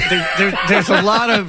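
mock benchwarmer. Mocking a benched Bulls player to his cohosts, in a hammed-up sarcastic voice. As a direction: ham up a clueless bench player.

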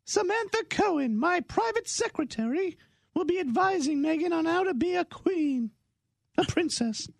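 very high, prim put-on queen voice; lofty royal announcement in falsetto.

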